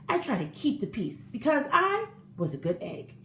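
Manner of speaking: usual speaking voice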